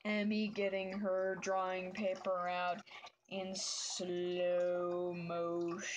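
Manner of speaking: extremely slow, stretched-out words; mock slow motion. Artist narrating getting drawing paper out, in slow motion. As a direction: drag every syllable like slowed-down tape.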